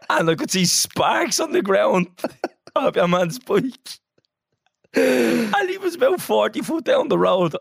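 high, slow, helpless amusement; real laughter breaking up the words; laughing while telling. Real, not acted. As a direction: tell it while cracking up. Cracking up at own story.

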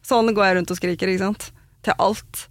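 ordinary delivery

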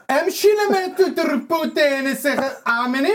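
high, slow mock Arabic gibberish; hammed-up angry Arabic imitation; fake angry Arabic.

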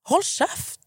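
real fed-up outburst, pitched high; real exasperated outburst. Real, not acted. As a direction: snap it out at people you can't stand. Venting about people who disrespect her.

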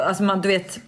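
ordinary delivery

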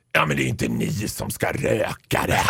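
low, weary deadpan; tired retort. Retold or imitated. Cashier tiredly answering the judging customers, low. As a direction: brush them off wearily. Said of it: "lite trött till dem så här".

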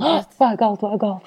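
high-pitched mock alarm; alarmed mother. Mamma reacts to the baby's sudden crying, mock-alarmed. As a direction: imitate a fretting mother, voice jumping up. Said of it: "til og med mamma reagerer alltid, hun blir sånn".

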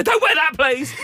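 high, loud desperate plea; pleading dad shout. Pretend. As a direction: beg your daughter not to wear it. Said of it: "apart from go".

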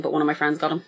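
ordinary talk, nothing marked

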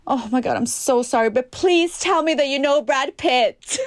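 lightly voiced pleading fan, slow; a hint of the stranger hoping for a Brad Pitt connection; imitating eager stranger. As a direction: beg a favour like a starstruck fan. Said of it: "Och sen han bara".

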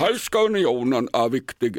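badly faked statesman with a dialect tinge; Carl Bildt. Shown as a demonstration. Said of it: "Är inte det Carl Bildt?".

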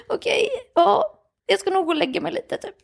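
high, whimpering mock sob; acted-out weepy resignation, pitched high; tearful. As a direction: give in through imitated tears. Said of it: "jag kunde inte sluta gråta".

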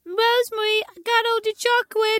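put-on very high-pitched child voice; imitated child tattling. Child telling Rosemary about the chocolate, high imitated kid voice. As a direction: squeal it like a kid giving away a secret.

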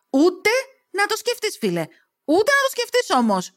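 high-pitched, indignant refusal; snarled warning. A narrator's reading. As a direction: warn him off sharply, voice up high. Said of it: "γρίλισες". (θυμωμένη, απότομη προειδοποίηση)

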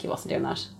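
unvoiced, dismissive whisper; whispered retort. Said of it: "And I was like".

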